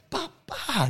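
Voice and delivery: high sharp bah bah punch noises; punch sounds. Retold or imitated. Arborist landing jabs on the guy, punch noises. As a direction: mimic two quick punches.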